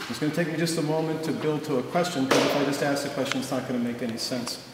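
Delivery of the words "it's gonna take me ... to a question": ordinary delivery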